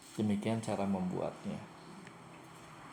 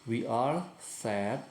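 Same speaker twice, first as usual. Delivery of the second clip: each English word laid out slowly; slow model sentence; much slower than usual. Shown as a demonstration. Teacher reads a new example to pupils, slow and deliberate. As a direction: pronounce it slowly for learners. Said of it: "teacher models example sentence".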